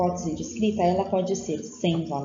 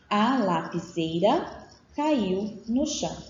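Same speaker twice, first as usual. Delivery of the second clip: slow, measured reading of "A lapiseira caiu no chão"; syllable by syllable; slower than usual. Shown as a demonstration. Teacher reading a child's sentence, "A lapiseira caiu no chão" slowly by syllables. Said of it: "a leitura é feita por sílabas".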